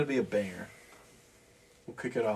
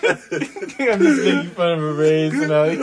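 laughing; 7 semitones higher, 13 dB louder, slower than usual. Acted. Laughing mock confession.

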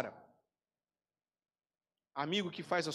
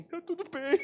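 tearful high laugh; laughing through tears; 12 semitones higher than usual. Retold or imitated. Pastor at the bakery, laughing while crying.

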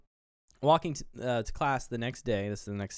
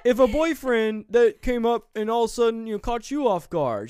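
higher, louder put-on voice; defensive husband voice; 11 semitones higher, 8 dB louder than usual. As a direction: needle her defensively. Husband challenges wife.

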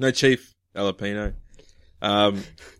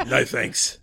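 mock British politeness; British accent; 2 semitones higher than usual. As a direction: decline in a put-on British accent. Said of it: "I'm British".

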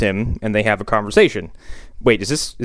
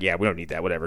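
dismissive brush-off; 6 semitones lower, 7 dB quieter, much faster than usual. Retold or imitated. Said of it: "brushes away".

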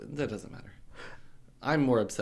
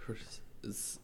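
hushed whisper, voiceless; half-giggling whispered question; 18 dB quieter than usual. Real, not acted. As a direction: whisper it like a naughty secret. Coy question about rude topic.

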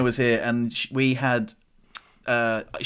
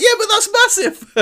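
high, loud protest through laughter; laughing; 8 dB louder than usual. Retold or imitated. Answering her tease about the spider, laughing high. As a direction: defend yourself while cracking up. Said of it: "I was like".

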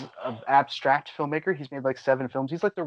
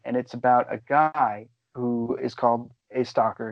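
ordinary delivery

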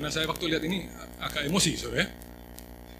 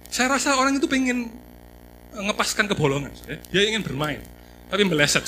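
mocking exclamation; 5 semitones higher, 8 dB louder than usual. Real, not acted. Preacher mocking the man who spat in the lift, loud and scornful. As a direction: pitch up and louder, half laughing at it.

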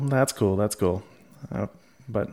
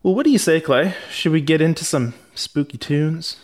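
bright, raised, eager host lead-in; cheerful segment handoff; 6 semitones higher, 5 dB louder than usual. Real, not acted. Host to co-host, upbeat and raised, kicking off the music.

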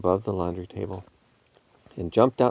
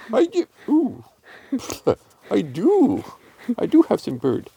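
high, odd cat voice; high-pitched garbled cat character; mouth-full cat; 13 semitones higher than usual. A narrator's reading. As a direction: talk as if full of chewed food.

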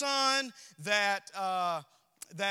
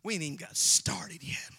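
hushed aside, almost no voice; whisper; 4 semitones lower, 8 dB quieter than usual. Real, not acted. Pastor teasing the congregation, whispered aside. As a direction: lean in and whisper it. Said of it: "unvoiced whisper".

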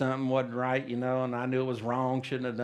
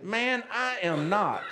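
higher, slow, drawn-out denial; emphatic denial; 6 semitones higher, slower than usual. Retold or imitated. Peter answering his accuser, insistent denial. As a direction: act out Peter's insistent denial, stretching the words. Peter denying he knows Jesus.

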